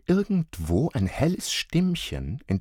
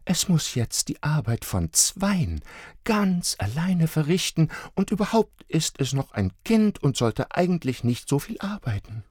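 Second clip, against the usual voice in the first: higher mother voice; excusing mother; 9 semitones higher than usual. A narrator's reading. Mother excusing the Christkind to the children, higher. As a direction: explain kindly like a mum. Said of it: "Julia entschuldigte seine Eile".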